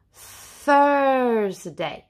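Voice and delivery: THURSDAY stretched, opening on a breathy th; THURSDAY with a long voiceless th; unvoiced TH. Shown as a demonstration. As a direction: draw THURSDAY out slowly for learners. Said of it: "Thursday goes with a th".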